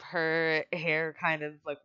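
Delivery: usual speaking voice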